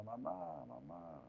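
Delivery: understated mock child's call, soft; hushed imitation of a distant child's cry; child calling mother. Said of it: "un niño en la playa grita".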